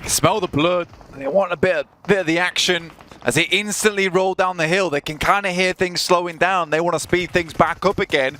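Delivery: excited play-by-play. Real, not acted. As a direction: call the teams rushing into the action. Live play-by-play of a fight.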